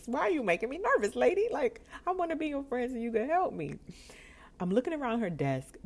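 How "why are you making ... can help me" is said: high, loud mock-nervous plea; nervous pleading. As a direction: play up the nerves and plead. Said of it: "Like, I'm nervous".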